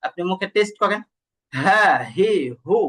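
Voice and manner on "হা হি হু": airy h starting each of "হা, হি, হু"; breath flowing out. Shown as a demonstration. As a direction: let air escape on "হা, হি, হু". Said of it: "দেখেন বাতাস বের হয় কিন্তু।".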